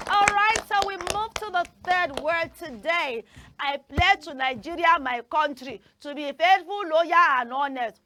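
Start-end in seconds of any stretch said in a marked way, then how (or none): 3.6-8.0 louder recited pledge with "country" as "count-ry"; chanted school pledge; pledge recitation with mispronunciation. Shown as a demonstration. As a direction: recite it the way children do. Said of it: "It is not country".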